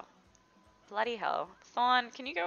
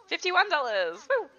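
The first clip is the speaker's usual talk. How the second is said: happy cheer; 8 semitones higher, 7 dB louder than usual. Real, not acted. Celebrating a sale.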